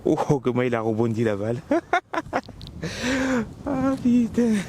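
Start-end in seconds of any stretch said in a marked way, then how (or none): 1.7-3.6 high laugh. Real, not acted. Ball bounces oddly, laughs. 3.6-4.5 surprised curse. Real, not acted. Reacts to ball bounce.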